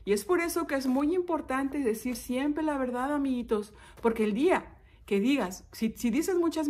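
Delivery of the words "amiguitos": ordinary delivery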